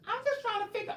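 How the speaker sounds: very high squeaky complaining voice; mocking a fan's voice. Mocking Bianca rushing home for the live, squeaky high whine. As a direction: whine like an impatient fan. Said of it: "that's just how y'all talk".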